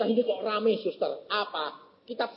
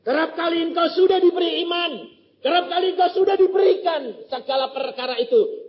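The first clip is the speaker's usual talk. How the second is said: much higher, louder sermon cadence; full-force preaching; 12 semitones higher, 7 dB louder than usual. Acted. Preacher urging the congregation, loud full-force preaching.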